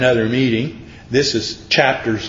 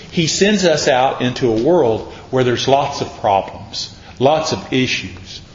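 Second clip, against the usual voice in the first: usual speaking voice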